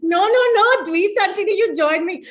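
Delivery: loud, high-pitched playful protest; playful urging. Real, not acted. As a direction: call out to join the laughing. Urging Auntie to join laughing.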